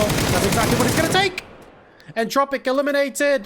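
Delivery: rising, high-pitched elimination call; excited play-by-play. Real, not acted. Caster calls Tropic being eliminated, excited. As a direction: build up and announce it with excitement.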